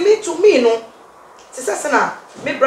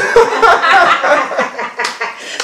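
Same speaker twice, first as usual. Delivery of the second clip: laugh; 4 semitones higher, 4 dB louder than usual. Real, not acted. Laughing mid argument.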